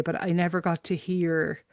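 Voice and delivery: ordinary delivery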